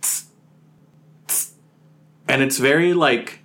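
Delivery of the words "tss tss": soft unvoiced tss sound; breathy tss like a faint cymbal; imitated hiss. Telling friends about the ghost noise, whispered tss. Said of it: "we start to hear this".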